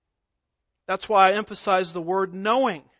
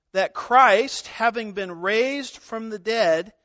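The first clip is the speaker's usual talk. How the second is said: usual speaking voice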